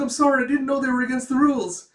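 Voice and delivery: fast, high, loud pleading; acted frightened schoolkid; panicked apology. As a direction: gabble an excuse in terror. Student pleads with inspector.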